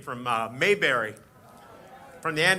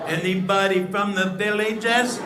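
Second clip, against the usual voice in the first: louder, slower, drawn-out call to the crowd; louder slower repeat; 4 semitones higher, 4 dB louder, slower than usual. Real, not acted. Comic calls again to silent crowd, louder and slower.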